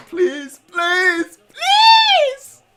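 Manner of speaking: beg, building to a high wail; desperate pleading. Real, not acted. Begging for the penalty call.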